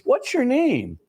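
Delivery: high-pitched retold boy's question; curious child. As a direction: ask as a curious little boy, lightly voiced. Boy questions his unknown sister.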